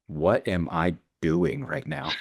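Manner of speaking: slow, incredulous aside to oneself; retold disbelief. Beekeeper scraping a star's finger, slow retold disbelief. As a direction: draw it out, mock bewilderment.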